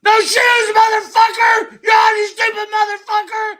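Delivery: high, loud furious mock rant; angry yell. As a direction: scream at him like a mad friend. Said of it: "Derek gets so mad".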